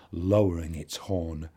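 ordinary delivery, nothing marked

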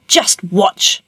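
put-on monster growl; roaring ogre. A narrator's reading. Ogre about to show Puss his magic, roaring. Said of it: "roared the ogre".

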